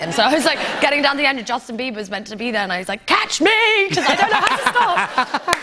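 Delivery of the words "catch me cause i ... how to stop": high, loud comic panic; hammed-up, slowed cry of alarm; panicked shout. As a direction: yell for help, skidding out of control. Retold panicked call while skating.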